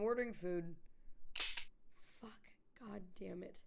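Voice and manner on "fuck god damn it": hushed swearing with no voice; whispered curse. Real, not acted. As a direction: grumble it under the breath. Cursing under breath at game.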